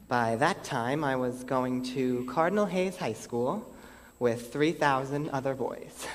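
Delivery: normal talking voice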